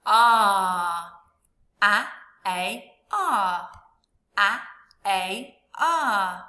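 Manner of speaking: loud drawn out ar, repeated; ar sound drilled. Shown as a demonstration. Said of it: "What sound did you hear?".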